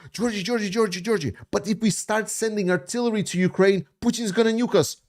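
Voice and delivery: high mocking voice of a panicky doubter; mocking critics. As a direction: ape the people who always warn him, pitched high. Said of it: "And then he's like".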